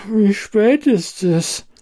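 slow, drowsy mumble; sleepy mumble. A narrator's reading. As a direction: mumble it half asleep. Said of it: "nuschelte sie".

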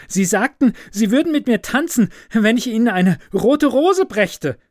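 high, fast, eager calling; eager student. A narrator's reading. Student offering the rose to the professor's daughter, high and eager. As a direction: rush to claim the promised dance. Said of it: "rief der Student".

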